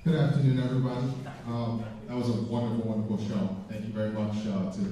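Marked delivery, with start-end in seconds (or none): none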